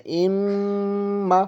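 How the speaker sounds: اما with the م held long through the nose, very slow; nasal ghunna. Shown as a demonstration. Teacher models the held nasal م in اما. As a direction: hum the م of اما for two seconds. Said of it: "اس طرح سے".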